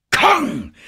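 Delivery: hoch und laut jubelnd; jubelnder Ruf. A narrator's reading. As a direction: juble das Wort heraus. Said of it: "rief Denham jubelnd". (triumphierender Aufschrei)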